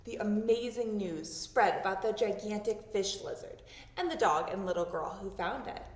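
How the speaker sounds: usual speaking voice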